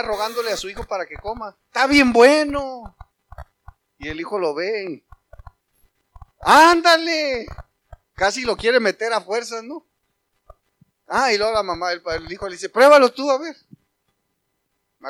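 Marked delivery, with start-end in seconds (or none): 1.7-3.0 drawn-out coaxing mom voice, higher and louder; coaxing mother. Retold or imitated. Mother coaxing her child to eat, coaxing. 6.4-7.5 long, high, loud coaxing "Ándale"; urging mother. Retold or imitated. Mother pushing her son to eat, drawn-out urging. 12.7-13.6 put-on loud, higher challenging kid voice; defiant child. Son challenging his mother over food, loud dare.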